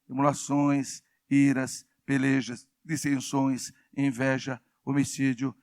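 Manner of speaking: measured one-word-at-a-time listing; scripture list recital. Real, not acted. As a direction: read off each item separately, on a level tone.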